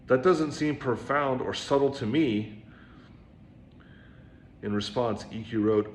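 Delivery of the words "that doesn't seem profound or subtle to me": loud, slow, annoyed complaint; exasperated and raised; irritable student. Acted. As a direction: push back, fed up with the master. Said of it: "The student became irritable".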